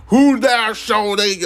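high, slow hammed-up threat; armed dad. Host imagining himself with the shotgun, slow high hammed-up threat. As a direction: drag it out like a mock showdown. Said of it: "I would've came out with a shotgun like".

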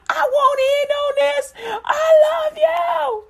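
very high, loud, slow hammed-up sob; Holy Ghost crying in. Holy Ghost joins the loving circle, mock crying. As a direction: barge in tearfully. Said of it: "the Holy Ghost is like".

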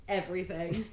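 ordinary delivery

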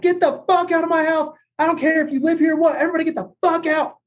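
high-pitched, fast mock shriek telling everyone to get out; retold screaming rage. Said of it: "Screaming, losing it".

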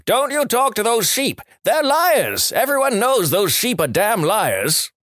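high-pitched panicky mock shout. Farmer warns ventriloquist off the sheep, high mock shouting. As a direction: play a flustered farmer shouting, without real volume. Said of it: "goes bright red and shouts".

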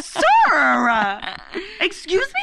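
indignant cry, then a high "excuse me"; mock outrage. Real, not acted. Friend reacting to the guy's dumb remark, mock indignant. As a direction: act shocked and offended.

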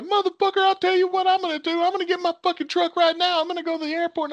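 very high-pitched angry imitation; mock furious rant. She vows to drive to the airport, mock furious. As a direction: imitate a caller on the warpath. Imitates her ranting on the phone.

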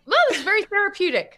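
high, mock-prim sarcasm in a changed voice; sarcastic put-on voice. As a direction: say it like a prim, ironic therapist. Mocking her own outburst.

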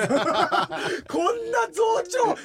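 loud, high exclamations through laughter; laughing mock outrage. Co-host reacting to a listener's cheeky request, laughing mock outrage.

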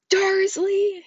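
huge, high, drawn-out bellow; booming Hagrid. A narrator's reading. Giant about to explode calls out Dursley, booming. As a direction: boom the name across the room. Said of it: "he boomed".

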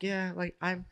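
put-on soft, high, slow girl voice; timid little woman, very softly. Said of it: "She sounded like [name]".

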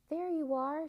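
loud, high welcoming cry; joyful cry. A narrator's reading. Old woman greets the returning children, crying out happily. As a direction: cry it out, beaming. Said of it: "she cried to the children".

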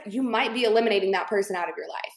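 normal talking voice

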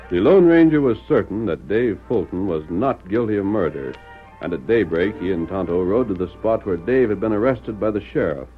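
usual speaking voice

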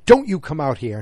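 imitated shouted warning; retold yell. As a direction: yell it as a sharp order, imitating her. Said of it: "she just yells at him".

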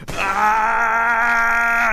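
a sudden high anguished scream; pained death scream. Acted. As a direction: scream as the light burns through you. Dying enemy screams in pain.

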